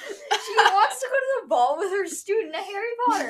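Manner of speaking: high-pitched, giggly insistence; laughing incredulity. Real, not acted. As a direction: push the silly theory through real laughter. Joking theory amid shared laughter.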